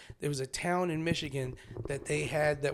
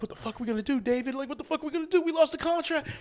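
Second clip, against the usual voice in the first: worried crowd; 10 semitones higher, faster than usual. Retold or imitated.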